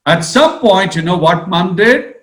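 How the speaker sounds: ordinary talk, nothing marked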